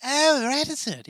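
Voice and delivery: very high, loud, drawn-out mimicry; imitating her pronunciation. Host mimics the way she says it, high hammed-up imitation. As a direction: echo the woman's voice in a squeaky, slow exaggeration. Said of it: "I like the way she says it".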